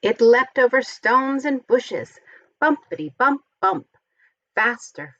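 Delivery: ordinary delivery, nothing marked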